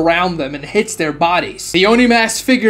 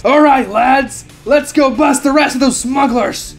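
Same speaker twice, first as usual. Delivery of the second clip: rally the men after the hit; triumphant captain; 6 semitones higher than usual. A narrator's reading. Captain cheering his lads, triumphant. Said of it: "celebrate as the captain says".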